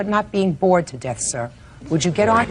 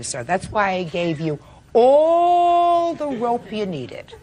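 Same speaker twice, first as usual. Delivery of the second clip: usual speaking voice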